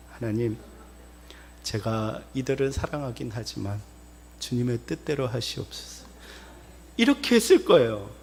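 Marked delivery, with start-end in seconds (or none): 0.1-6.1 low, hushed submission; meek prayer. Acted. Preacher imagining his answer to God, meek and hushed. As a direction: yield softly and humbly, voice dropped. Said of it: "저에게 그런 말을 하신다면".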